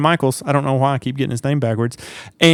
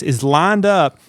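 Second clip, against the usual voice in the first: normal talking voice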